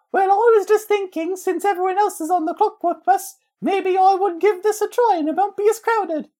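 very high, piping orphan voice; squeaky London waif from Dickens; Dickensian orphan. Acted. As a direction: play a little Dickensian urchin. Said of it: "sound like a Dickensian orphan".

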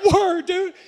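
high, elated reply; excited agreement. Retold or imitated. Student answering thrilled police officer, excited.

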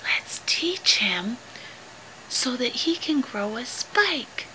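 hushed whisper to a friend. A narrator's reading. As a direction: breathe it like a secret. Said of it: "Mike whispered to Freddie".

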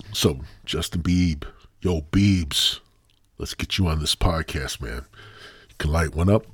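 usual speaking voice

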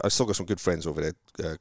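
ordinary talk, nothing marked

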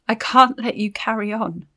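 ordinary delivery

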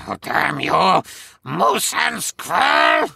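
put-on high, slow villain accent; drawn-out cartoon villain curse; Boris voice. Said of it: "I don't know how to do all those voices".

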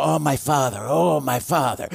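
lightly imitating a gushing believer; a hint of overdone piety, sighing the words; mocking pious believers. Pastor mimicking believers who pray too sentimentally, mild mock gushing. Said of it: "They go".

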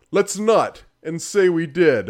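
hammy game-narrator voice; exaggerated announcer. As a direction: deliver a corny joke as a sci-fi announcer. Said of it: "really exaggerated sort of".

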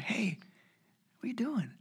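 hushed parent whisper; soft unvoiced whisper. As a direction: whisper it by a child's bed at night. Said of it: "I'm like".

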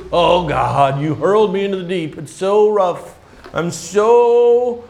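hammed-up moaning to God; mock whiny complaint. Preacher imitating Jonah complaining to God, mock whine. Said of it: "he goes".

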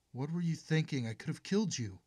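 high, loud furious scolding; angry scolding. Acted. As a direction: tear into her, shaken and furious. Mother scolds her daughter.

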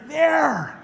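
high imitated cry with mouth open; mock shout. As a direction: shout it high, acting out the patient. Acting out Bill pointing at teeth.

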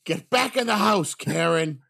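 a high, loud mock yell at his wife; raging dad. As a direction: bark it like the raptor dad chasing Karen inside.

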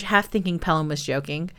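usual speaking voice

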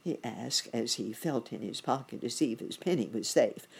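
ordinary delivery

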